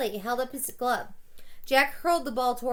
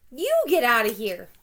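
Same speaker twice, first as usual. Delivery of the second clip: louder furious yell back; angry yell; 7 dB louder than usual. A narrator's reading. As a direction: whirl round and yell it at the hecklers. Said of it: "He whirled around and yelled".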